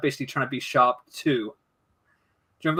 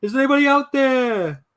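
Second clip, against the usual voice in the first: hammed-up Welsh cry into the distance; exaggerated Welsh Titanic impression; 8 semitones higher, 5 dB louder than usual. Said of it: "an exaggerated impression of him from Titanic".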